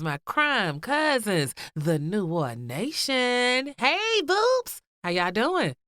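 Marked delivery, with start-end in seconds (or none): 3.8-4.8 excited high greeting. Real, not acted. Host greeting her listeners with a high excited hey. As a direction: squeal a bright high hello.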